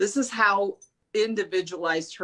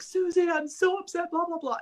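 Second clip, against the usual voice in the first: mock upset whine; 11 semitones higher than usual. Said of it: "And I'm like".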